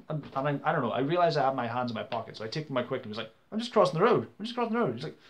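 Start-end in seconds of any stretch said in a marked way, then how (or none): 3.5-4.9 high, urgent retold protest; alarmed protest. Pedestrian to man who grabbed his backpack, alarmed protest. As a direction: plead your innocence, pitched up.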